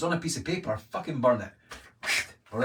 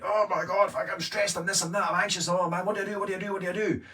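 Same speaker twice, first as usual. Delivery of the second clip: high-pitched frantic fretting, fast and anxious; stressed out panic; 7 semitones higher than usual. Retold or imitated. A tired person home at night, spiralling, in a high frantic panic. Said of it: "you're coming home at night and you're already like".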